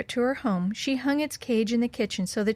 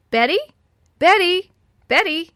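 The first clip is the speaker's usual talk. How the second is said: raised, higher calls of a name; calling out; 9 semitones higher, 7 dB louder than usual. A narrator's reading. Old woman tests the parrot, calling Betty's name loudly. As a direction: call out for someone out of sight. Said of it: "Then the old woman called".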